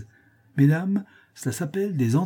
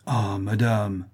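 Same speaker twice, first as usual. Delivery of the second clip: exclaiming; 4 semitones lower, much slower than usual. A narrator's reading. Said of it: "s'écria-t-il".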